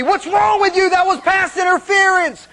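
loud, high mock yell at the TV; wife yelling at TV. Pastor imitating his wife yelling at the TV, loud. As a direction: yell at the referee like a diehard fan. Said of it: "She's screaming".

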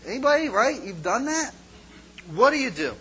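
usual speaking voice

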